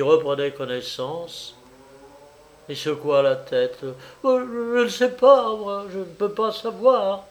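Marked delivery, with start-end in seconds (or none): 4.2-7.2 high, flustered stammer; flustered, faltering excuse. A narrator's reading. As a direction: stammer helplessly, pitched up. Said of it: "dit-elle en bredouillant".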